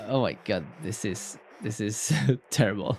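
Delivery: ordinary delivery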